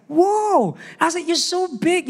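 high-pitched mock awe; retold amazement. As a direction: react wide-eyed, voice shooting up. Retelling his amazement at a huge man.

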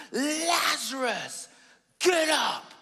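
high, loud shout of the name; shouting the dead man awake; commanding shout. Retold or imitated. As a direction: bark the order at the tomb, drawing out Lazarus. Jesus commands Lazarus, not pastoral.

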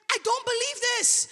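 high-pitched retold disbelief; imitated tearful disbelief. Imitating woman crying over her healed knee, tearful disbelief. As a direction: imitate her overwhelmed cry, pitched well up. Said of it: "she's crying and she's saying".

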